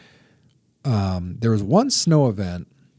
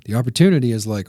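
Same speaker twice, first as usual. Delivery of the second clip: ordinary talk, nothing marked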